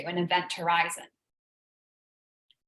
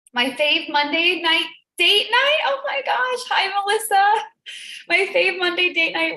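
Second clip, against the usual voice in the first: excited sing-song greeting; 9 semitones higher, 5 dB louder than usual. Real, not acted. Excited greeting opening the live show.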